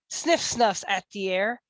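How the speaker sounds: ordinary delivery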